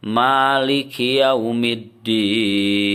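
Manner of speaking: "Maliki" with ma held two beats, chanted; long ma in recitation. Shown as a demonstration. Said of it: "maknya panjang dua harokat". ("Maliki" dengan ma dipanjangkan dua harakat)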